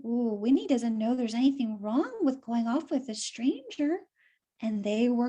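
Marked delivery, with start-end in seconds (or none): none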